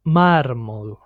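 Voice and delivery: "mármol" with trilled R before the M; careful "mármol" with a Spanish trill; multiple R. Shown as a demonstration. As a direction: roll the R at the end of "már" in "mármol". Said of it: "we say it multiple".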